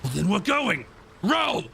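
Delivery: high, pushy bosun order; acted impatient order to rowers; mock command. Bosun character orders crew to row.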